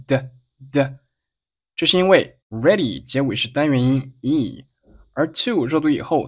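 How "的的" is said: weak "to" said as a light "de"; a short flapped "的" standing for "to". Shown as a demonstration. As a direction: tap the tongue for "的". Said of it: "读成了一个弹舌音的".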